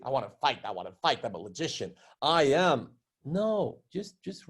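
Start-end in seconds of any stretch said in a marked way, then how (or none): 0.1-2.8 low mock combative voice; mocking Sarah. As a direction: lower and mock her wanting to fight. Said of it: "the way you speak, nobody else speaks that way".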